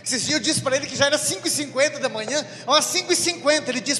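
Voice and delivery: high, loud, exasperated wife; shrill-free outrage building up; wife complaining to the priest, rising to a loud peak; indignant wife. Retold or imitated. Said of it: "wife retorts about husband's jokes".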